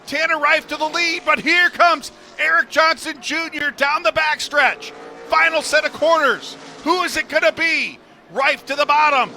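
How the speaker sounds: high, loud, building final-lap call; rising finish-line play-by-play; excited race call. Acted. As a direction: ride the finish like the crowd is on its feet.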